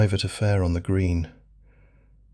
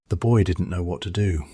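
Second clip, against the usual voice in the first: usual speaking voice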